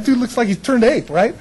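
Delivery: normal talking voice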